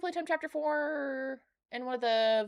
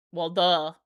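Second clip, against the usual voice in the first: mocking duh; 6 semitones lower, 8 dB louder than usual. Real, not acted. Mocking aside to the letter.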